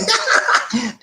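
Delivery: short high burst of laughter. Real, not acted. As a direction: laugh at your own teasing. Laughs after teasing a listener.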